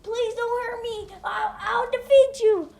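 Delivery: high, loud, shaky-fearful plea; scared kid begging the fox, then bluffing; frightened pleading. Acted. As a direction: beg it, then fake some courage. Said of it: "fearing the boy".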